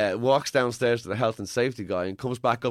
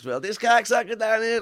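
put-on New York accent; 12 semitones higher, 5 dB louder than usual. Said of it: "kangaroo would have a New York voice".

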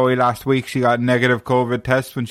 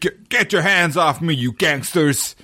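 higher, slow hammed-up Heston; Charlton Heston again; 4 semitones higher, slower than usual. Joking that Heston has no range, slow hammed-up line. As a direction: drag it out like a stiff movie hero. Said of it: "He had no range".

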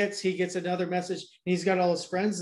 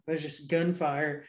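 ordinary talk

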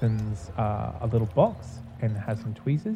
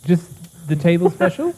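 put-on higher old gnome hostess; raised old gnome woman voice; older gnome woman; 6 semitones higher, 4 dB louder than usual. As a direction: ask it as a high-voiced old lady. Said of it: "older gnome woman".

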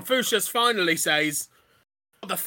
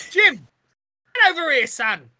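loud, high mum calling; summoning shout; 6 semitones higher, 5 dB louder than usual. Acted. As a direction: call the boy over sharply from afar. Mum calls her son over.

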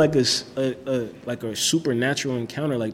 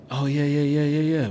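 retold string of quick yeahs, pitched up; quick agreement; 4 semitones higher, 4 dB louder than usual. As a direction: play along with rapid, level, higher yeahs. Retold bluffing reply to classmate.

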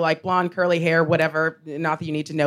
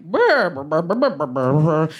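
wordless "brr" murmur, trailing lower; imitated crowd mumbling; 3 semitones lower, slower than usual. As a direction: mimic people mumbling instead of speaking up. Imitates the bus crowd's non-response.

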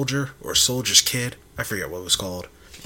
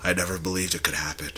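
soft, hushed movie voiceover; dramatic whisper; 8 semitones lower, 11 dB quieter than usual. Pretend. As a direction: breathe it like a tragic monologue. Mock dramatic monologue.

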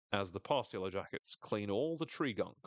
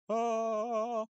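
eerie music imitation; 14 semitones higher, 6 dB louder than usual. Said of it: "It's like".

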